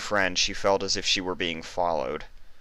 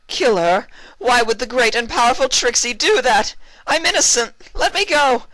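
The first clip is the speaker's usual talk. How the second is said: shrill desperate screech, much higher and louder; condemned mare screeching at Twilight to save her; 9 dB louder than usual. Acted. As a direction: shriek your innocence at the guillotine. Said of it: "she screeched".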